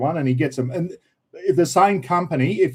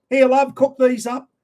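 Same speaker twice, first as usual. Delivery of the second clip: lightly voiced Aussie bloke, higher and louder; a hint of a matey husband tossing over dinner; bloke home from the pub; 8 semitones higher, 3 dB louder than usual. Husband home from the pub telling his wife to cook, lightly voiced.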